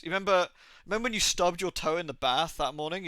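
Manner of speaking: ordinary delivery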